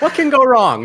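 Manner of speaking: high, loud laughing quip; laughing joke. Real, not acted. Host joking to co-hosts about covid in space, laughing. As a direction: crack up while asking it, pitch leaping.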